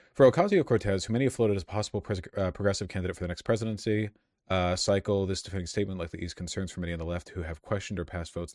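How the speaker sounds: quick, subdued reading of news copy; reading an article. Real, not acted. Reads a news article aloud.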